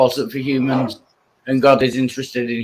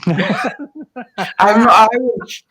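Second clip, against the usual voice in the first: laughing protest; 6 semitones higher, much slower than usual. Real, not acted.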